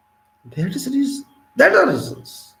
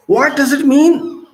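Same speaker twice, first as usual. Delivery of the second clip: pointed rising outcry; loud, high question; 10 semitones higher, 7 dB louder than usual. Real, not acted. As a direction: ask it much higher and louder, slowly. Rhetorical question repeated louder.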